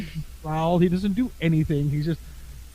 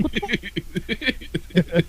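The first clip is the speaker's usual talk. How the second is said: drawn-out startled; 5 semitones higher, 3 dB quieter, much slower than usual. Retold or imitated. Dog bursts out, scares him.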